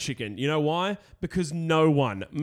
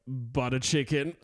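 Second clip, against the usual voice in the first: deadpan butter chicken; 4 semitones lower, 5 dB quieter than usual. Retold or imitated. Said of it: "he's like".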